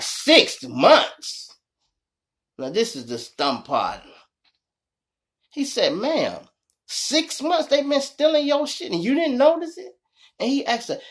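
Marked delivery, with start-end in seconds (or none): 0.0-1.5 incredulous cop. Retold or imitated. Officer shocked at the answer. 5.9-9.9 disbelieving officer. Retold or imitated. Officer scolding the wife.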